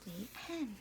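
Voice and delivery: very soft, hushed close; storyteller closing the book for children; soft ending. A narrator's reading. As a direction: let the story settle gently. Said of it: "soft close of story".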